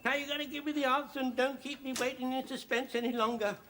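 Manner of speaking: high-pitched, ardent phone declaration; eager suitor. Acted. Suitor phones his darling for her answer, eagerly pleading. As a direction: plead eagerly with your sweetheart.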